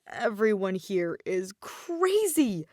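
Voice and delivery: slow, weary complaint; exasperated. A narrator's reading. Aether fed up with Wonderland, slow and exasperated. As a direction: sigh it out, fed up.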